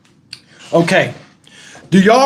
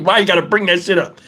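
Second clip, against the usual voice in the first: ordinary talk, nothing marked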